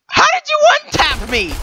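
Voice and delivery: very high, indignant outburst; mad disbelief. Real, not acted. Player to friend after being one-tapped, mad disbelief. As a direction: protest it way up high. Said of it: "Are you mad?".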